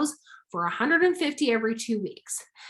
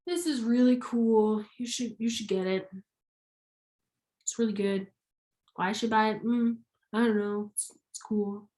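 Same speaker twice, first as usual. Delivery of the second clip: flat, bored sales pitch, trailing off; half-hearted mumbled pitch; unexcited; 3 dB quieter than usual. Shown as a demonstration. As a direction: sell it like you don't care. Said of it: "That is not very excited".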